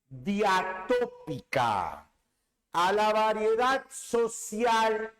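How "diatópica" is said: slow repeat for dictation. Shown as a demonstration. Teacher to students, dictating the term slowly. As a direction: say "diatópica" slowly, syllable by syllable. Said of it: "también es llamada diatópica".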